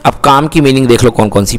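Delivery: ordinary delivery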